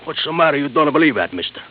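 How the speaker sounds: heated protest. Acted. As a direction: insist hotly that it's true. Still defending himself against the accusation.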